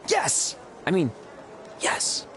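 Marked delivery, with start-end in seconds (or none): 0.1-0.6 high, excited exclamation; high burst of excitement; excited yes. Acted. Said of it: "Don't get too excited". 1.8-2.3 reined-in, composed yes; excitement held back; contained yes. Acted. Restating the yes after "I mean.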